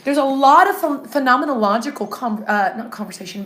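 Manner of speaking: usual speaking voice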